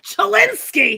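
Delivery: high, loud put-on squawk; Babs voice. Echoing the co-host's call, high Babs impression. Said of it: "it kind of is the Babs voice".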